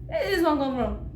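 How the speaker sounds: high, loud mock disbelief; incredulous reaction. Onlooker hearing a friend's fake accent, loudly incredulous. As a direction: exclaim it loudly, imitating a baffled onlooker.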